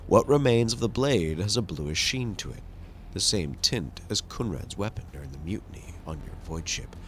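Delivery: ordinary talk, nothing marked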